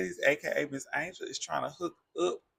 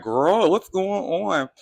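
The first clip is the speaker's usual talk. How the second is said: slow, loud, higher sassy voice; loud drawn-out question; 5 semitones higher, 8 dB louder, slower than usual. Retold or imitated. Stacy reacting to tension.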